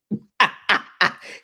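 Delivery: laugh. Real, not acted. Laugh after the quote.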